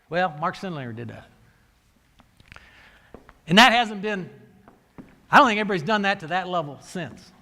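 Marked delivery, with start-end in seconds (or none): none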